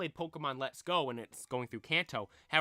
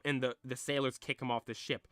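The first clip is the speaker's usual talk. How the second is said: usual speaking voice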